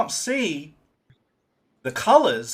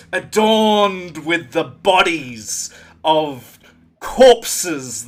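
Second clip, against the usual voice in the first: louder, slow, grand narration; nature documentary; 6 dB louder, slower than usual. Acted. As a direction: narrate it like an awed epic. Grand narration of majestic creature.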